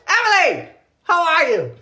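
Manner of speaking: high-pitched, excited hello; delighted greeting. Real, not acted. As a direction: greet a favorite viewer with delight, voice way up.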